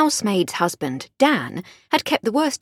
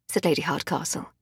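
ordinary delivery, nothing marked

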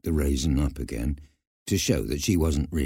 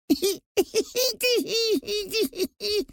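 very high mouse giggling; squeaky peal of tittering; explosion of giggles. A narrator's reading. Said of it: "a great explosion of giggles".

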